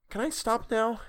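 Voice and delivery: put-on a girl asking to rest her arm; girl's voice. Violet voiced in story.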